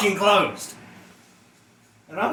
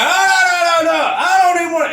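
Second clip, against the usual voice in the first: loud, high protest of no, no, no; shouting no; 10 semitones higher, 6 dB louder than usual. Retold or imitated. Husband yelling back at his wife, loud. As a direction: shout it down, raised and fast.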